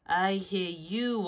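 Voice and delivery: slow, soft, shadowy voice; a hint of a mysterious stranger. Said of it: "I can't do this voice anymore".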